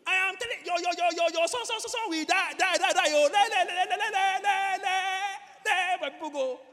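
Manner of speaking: shouted high stammer, stuck on syllables; stammering Moses. Acted. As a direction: fight through a long, very loud stutter. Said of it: "Moses was a stammerer".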